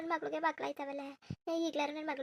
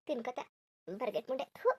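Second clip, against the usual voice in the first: bitter low cursing; 6 semitones lower, 5 dB quieter than usual. Acted. Mother cursing the mother-in-law.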